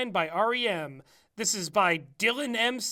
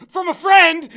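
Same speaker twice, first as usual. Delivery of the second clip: loud, high, laughing protest; laughing shout; 8 semitones higher, 12 dB louder than usual. Real, not acted. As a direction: yell it defensively while laughing.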